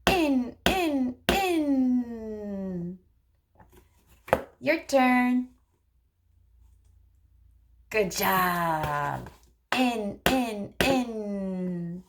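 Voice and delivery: put-on speech therapist modeling turn-taking for a child, sing-song. Shown as a demonstration. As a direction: model it slowly and playfully for a toddler. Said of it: "you could say".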